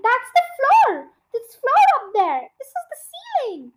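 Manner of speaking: high-pitched, loud alarmed cries; shrill-pitched panicked exclaiming; acted screaming. A narrator's reading. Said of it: "screamed Missus Twit".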